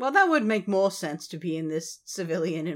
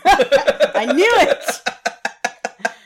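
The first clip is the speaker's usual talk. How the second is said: loud laughing gotcha; laughing triumph; 7 semitones higher, 9 dB louder, much slower than usual. Real, not acted. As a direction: crow it out while laughing.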